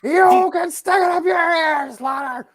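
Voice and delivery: high, slow hammed-up shout; wrestler shout. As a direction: yell it like a cartoon wrestler. Imitating Piper's yell.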